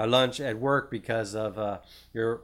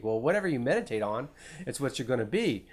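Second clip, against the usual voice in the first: usual speaking voice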